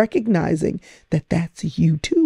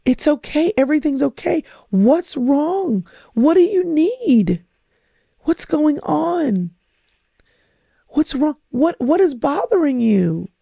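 put-on soothing a crying toddler; 8 semitones higher than usual. Parent comforting a crying baby up close, tender and soothing. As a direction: hush a crying toddler with gentle reassurance. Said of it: "we bring them close and we say".

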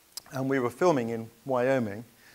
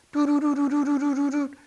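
steady high-pitched beeping alarm sound; imitated alarm beeps; 7 dB louder than usual. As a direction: mimic a cockpit warning alarm, high and monotone. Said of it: "the alarms went off".

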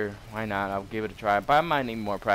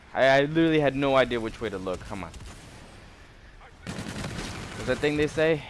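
ordinary talk

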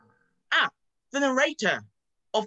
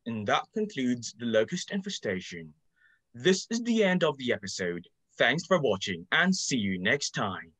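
documentary narrator; 11 semitones lower than usual. Acted.

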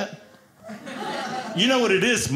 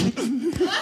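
high burst of laughter; 9 semitones higher than usual. Real, not acted. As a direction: laughs at his own excuse. Comic laughs at own punchline.

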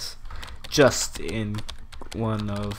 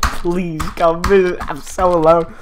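loud, high, drawn-out begging; mock desperate pleading; 6 semitones higher, 12 dB louder, slower than usual. Player begging the friend to visit, hammed-up desperate plea.

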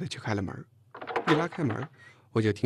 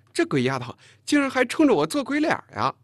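loud, high-pitched exclamation; indignant outburst; 13 semitones higher, 7 dB louder than usual. A narrator's reading. Chen Jing outraged at the girl's cheek, loud outburst. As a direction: blurt it out, scandalised. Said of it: "陈静咋呼了一声".

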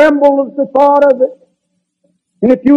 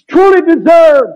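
preaching shout; 5 semitones higher, 3 dB louder than usual. Real, not acted. Preacher driving home a point.

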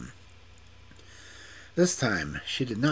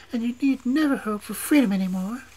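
much higher and louder, final; angry witch; 7 dB louder than usual. A narrator's reading. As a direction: end the sentence high and loud. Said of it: "said in an angry voice".

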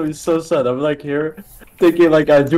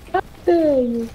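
mock outrage, higher; 12 semitones higher than usual. Mock outrage at teammate, rising.

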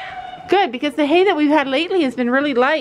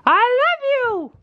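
coaxing dog; 10 semitones higher than usual. Shown as a demonstration.

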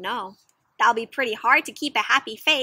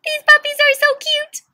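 much higher, sweet chirpy voice; cheerful Kevin; 14 semitones higher, 5 dB louder than usual. Acted. Kevin chased by dogs, chirping much higher. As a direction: gush over dogs that are chasing you. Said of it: "look what he says".

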